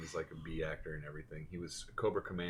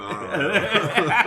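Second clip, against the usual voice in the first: laughing; 12 semitones higher, 16 dB louder, much slower than usual. Retold or imitated. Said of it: "And I'm like".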